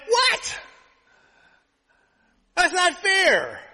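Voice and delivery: very high, loud, drawn-out mock protest; indignant complaint. Son hearing brother praised for a D, mock indignation. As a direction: play an outraged kid crying foul. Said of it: "so I kinda complained".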